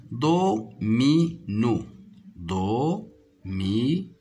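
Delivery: "Domino" slowly split do, mi, no; slow sounding-out of "Domino" with gaps between syllables. Shown as a demonstration. Teacher showing pupils three syllables in "domino", syllable by syllable. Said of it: "se compose de trois syllabes".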